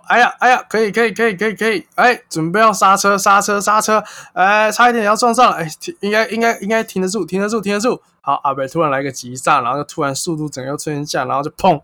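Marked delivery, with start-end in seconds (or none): 0.1-8.0 high, loud flustered braking chatter; mock panic. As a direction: replay the panic of nearly crashing, in a rush.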